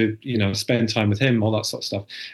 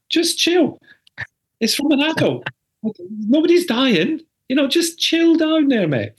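mock chill-out; 13 semitones higher than usual. To the stressed husband, a high teasing chill-out. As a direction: tell him to calm down, teasing. Dad telling partner to calm.

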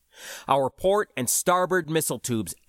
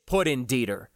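plain reading voice

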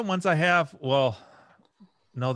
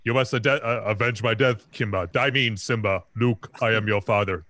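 James Earl Jones voice; 4 semitones lower than usual. Retold or imitated. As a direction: boom it out low like Mufasa. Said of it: "where he's like".

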